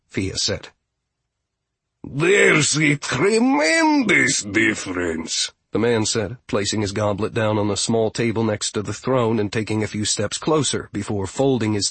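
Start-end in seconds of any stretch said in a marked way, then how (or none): 2.0-5.5 put-on slow, high-swinging eccentric old character; insistent steward. Steward insists on the difference, drawn-out and emphatic. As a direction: stretch it out with affronted emphasis.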